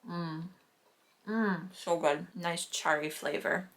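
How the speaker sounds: chewing while talking; mouth full. Real, not acted. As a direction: talk around the first bite. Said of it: "I will take my first bite".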